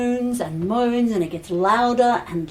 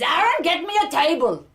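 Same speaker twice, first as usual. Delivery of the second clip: raised-pitch brisk order; urgent command; 7 semitones higher than usual. Acted. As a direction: order him to fetch it now. Healer giving an urgent order.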